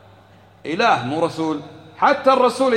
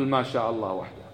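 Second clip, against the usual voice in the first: ما شاء الله وحده said softer and lower; corrected phrasing; 8 semitones lower, 8 dB quieter than usual. Shown as a demonstration. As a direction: give the right form quietly and firmly. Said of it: "قل". (التصحيح بصوت أهدأ وأخفض)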